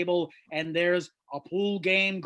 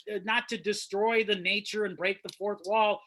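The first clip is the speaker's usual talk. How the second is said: ordinary talk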